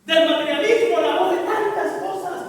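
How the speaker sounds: insistent preacher listing temptations; impassioned preaching. Real, not acted. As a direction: hammer out each item of the list. Preaches at full force. (predicación enérgica, enumerando con fuerza)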